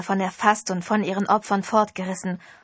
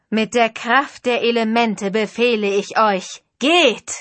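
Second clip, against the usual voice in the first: final command; 6 dB louder than usual. Acted. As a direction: build to a ringing order.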